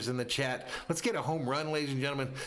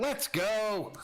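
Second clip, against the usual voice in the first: mock scream; 9 semitones higher than usual. Announcer relays Betts's cry from second, mock scream. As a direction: imitate a player's triumphant cry without full volume. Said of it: "screams out".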